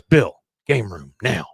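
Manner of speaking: slow, loud imitated command; stern order. Hank pulls Bill aside, stern order. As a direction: order him out firmly, word by word.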